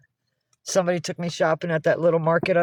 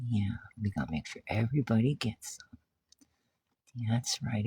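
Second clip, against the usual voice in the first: softer whispered reassurance; 5 semitones lower, 9 dB quieter than usual. Real, not acted. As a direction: hush the cats while they share. Hushed talk to cats.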